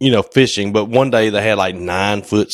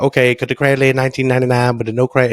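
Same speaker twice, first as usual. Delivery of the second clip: quick, higher mock Chinese accent; imitated Chinese accent; 4 semitones higher, faster than usual. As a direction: keep pitching it in a put-on Chinese accent. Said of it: "he kept repeating it".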